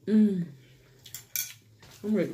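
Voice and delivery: muffled between bites; talking while chewing. Real, not acted. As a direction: mouth partly full of food. Seen 1: Forkful to mouth at -1.0; jaw chewing, lips closed through 2.1.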